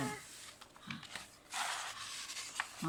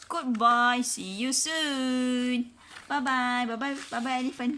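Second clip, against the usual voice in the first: cheerful goodbye; 5 semitones higher, 5 dB louder than usual. Real, not acted. Farewell to child.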